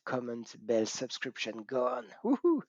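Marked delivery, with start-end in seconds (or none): 2.2-2.6 high little whoop; excited cheer. Real, not acted. Host cheering cheekily after asking viewers to subscribe.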